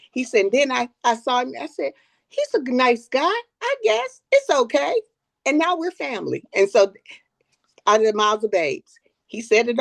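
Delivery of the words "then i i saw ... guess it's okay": much higher, lightly played boy shrugging; a touch of a doubtful grandson; imitating a child. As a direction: mimic a kid sizing someone up. Grandson's speech retold by grandma.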